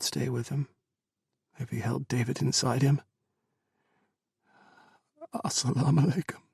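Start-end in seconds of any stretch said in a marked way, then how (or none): 5.3-6.4 choked out. Acted. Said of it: "he choked out".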